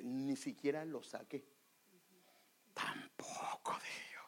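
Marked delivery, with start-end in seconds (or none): 2.7-4.2 unvoiced whisper of a private thought. Preacher recalls his silent doubt, whispered. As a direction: breathe it as an inner retort. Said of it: "¿sabe qué dije yo en mi mente?".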